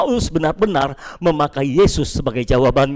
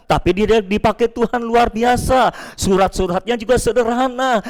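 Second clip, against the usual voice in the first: higher-pitched voice of a dissenting faction; acted follower, pitched up and insistent; Peter's partisans; 5 semitones higher than usual. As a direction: dismiss the rivals, champion your own. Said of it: "muncul lagi yang berbeda pikirannya".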